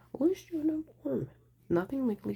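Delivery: "I'll" punched up high, then falling off; emphatic repeat. Retold or imitated. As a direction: hit "I'll" high and let it fall. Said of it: "he said".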